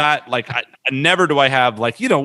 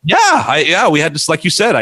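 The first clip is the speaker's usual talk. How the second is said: excited; 5 semitones higher, 5 dB louder than usual. Real, not acted. Excited recall of car story.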